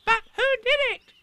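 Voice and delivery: put-on very high, loud little child voice; a tiny eager joey; Roo's voice. A narrator's reading. Roo asks Eeyore, very high and eager.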